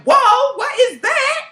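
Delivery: high, loud burst of shock; loud startled reaction. Retold or imitated. Woman reacting to his cologne, loudly startled. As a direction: blurt it out loud, high-pitched. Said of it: "I said it out loud".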